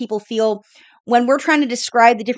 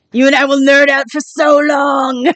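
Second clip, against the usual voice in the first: excited exclamation; 2 semitones higher, 5 dB louder than usual. Real, not acted. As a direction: belt it out to a fellow nerd. Gleeful exclamation about nerding out.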